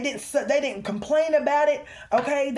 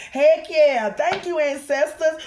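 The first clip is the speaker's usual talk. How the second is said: loud, higher, elated thanks; real excitement; 5 semitones higher, 6 dB louder than usual. Real, not acted. Reader thanking ancestors for the energy, loudly excited. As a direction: call it out, fired up.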